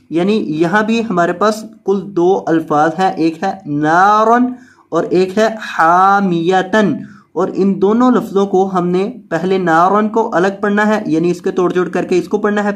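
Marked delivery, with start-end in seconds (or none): none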